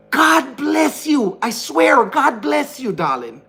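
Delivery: slow, high, mock-sweet "God bless you"; drawn-out sarcastic blessing to the critic. Real, not acted. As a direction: bless her with biting sarcasm.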